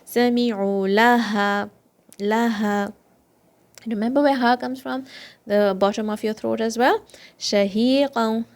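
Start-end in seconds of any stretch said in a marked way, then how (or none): 0.1-2.9 Sami'u laha drawn out, ain from the throat; slow recitation. Shown as a demonstration. Teacher models Sami'u laha slowly. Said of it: "sami'u and two counts again". 7.4-8.5 Shahiqan slowly, sheen spread with air; slow recitation. Shown as a demonstration. Teacher models Shahiqan slowly for students. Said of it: "remember sheen?".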